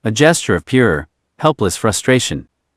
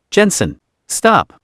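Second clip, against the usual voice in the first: panicked plea; 7 semitones higher than usual. Acted. Panicked flashback in the crash.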